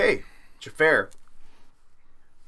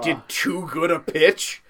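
normal talking voice

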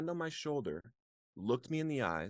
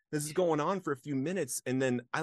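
ordinary talk, nothing marked

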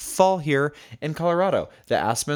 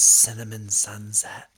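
whisper; 3 semitones lower, slower than usual. Acted. Mocking whisper of tea name.

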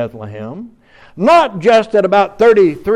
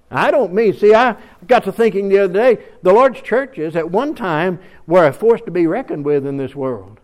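usual speaking voice

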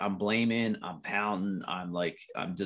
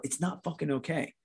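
restrained retold frustration, moderately raised pitch; inner protest; 6 semitones higher, 4 dB quieter than usual. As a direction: voice the private thought under the agreeable front. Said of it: "But inside, I'm sitting there going".

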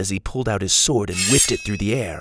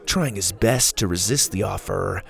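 plain reading voice

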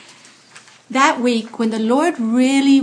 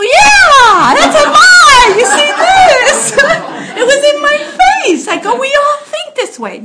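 high, loud, laughing exclamation; laughing shout; 12 dB louder than usual. Retold or imitated. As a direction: cry it out through laughter, much higher. Retelling her laughing reply to Hector.

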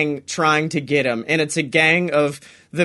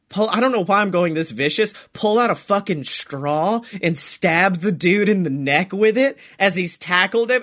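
ordinary talk, nothing marked

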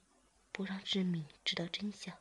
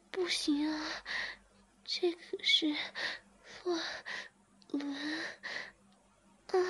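much higher, moaning, drawn out at the end; breathless character voice; 11 semitones higher than usual. Acted. Zhiming protests to master, then moans.

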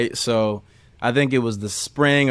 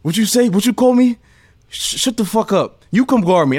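high, loud riled-up yell; angry yell; 10 semitones higher, 4 dB louder than usual. Retold or imitated. Josh answering trash talk with a high angry yell. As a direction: imitate a hot-headed player snapping back. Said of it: "And he's like".

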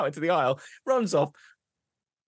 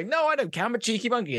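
high-pitched, brazen brush-off; cheeky monkey; 9 semitones higher, faster than usual. Acted. Cheeky monkey to the woman at the airport desk, high brazen voice. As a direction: answer back shamelessly, voice up high.

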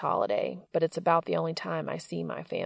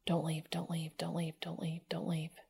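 pleading whisper, fading softer; desperate whisper; 11 dB quieter than usual. A narrator's reading. Dean's desperate silent inner plea.

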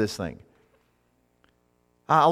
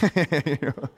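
laughing at own joke. Real, not acted. Joke about obvious Greek meaning.

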